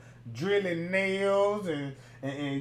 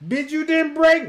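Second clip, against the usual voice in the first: mock challenge; 8 semitones higher, 9 dB louder than usual. Acted question to the mother.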